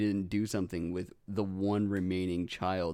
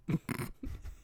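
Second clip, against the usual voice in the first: breathless suppressed giggle; stifled giggles; 8 dB quieter than usual. Real, not acted. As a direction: hold in the laugh, air only. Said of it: "[name]'s trying not to laugh".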